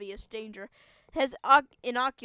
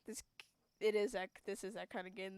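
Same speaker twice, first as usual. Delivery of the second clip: normal talking voice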